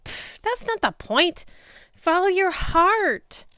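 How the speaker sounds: high, slow dismissive then dreamy; lightly voiced goddess, slow and airy; breezy goddess. A narrator's reading. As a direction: wave it off and swoon follow your heart. Aphrodite dismissing war, urging love.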